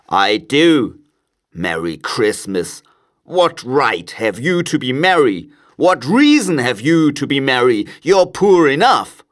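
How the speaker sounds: put-on grumpy old miser, rising to a loud finish; indignant miser, pitch climbing as he argues; scornful Scrooge. A narrator's reading. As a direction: scoff with mounting irritation. Scrooge scorns his nephew.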